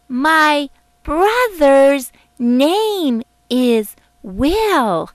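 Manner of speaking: put-on slow, emphatic, word by word like talking to a baby; slow and clear. A narrator's reading. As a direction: spell it out slowly as if to a baby. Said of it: "She spoke slowly and clearly".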